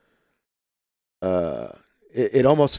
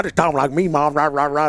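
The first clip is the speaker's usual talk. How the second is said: higher, louder country-twang gibberish; exaggerated country twang; 6 semitones higher, 4 dB louder than usual. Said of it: "I was probably the countryest thing".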